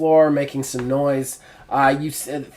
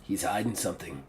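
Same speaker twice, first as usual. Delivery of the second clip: voice in your head; 7 semitones lower, 13 dB quieter than usual. Acted. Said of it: "the voice in your head goes".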